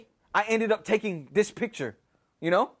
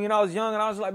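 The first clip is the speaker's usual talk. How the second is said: usual speaking voice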